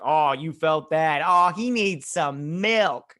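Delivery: slow, loud, drawn-out taunt; mock jeering. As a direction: crow over a landed punch, hammed-up. Jeering after the sucker punch.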